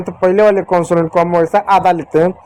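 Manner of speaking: usual speaking voice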